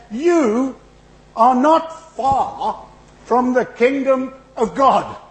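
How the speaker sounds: slow, high and loud, each word weighty; solemn proclamation. Acted. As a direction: announce it slowly like a verdict. Jesus answers the scribe.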